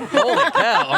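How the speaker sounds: high burst of laughter, slow; amazed laugh, words stretched. Real, not acted. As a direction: laugh out in disbelief. Reacting to flag photo.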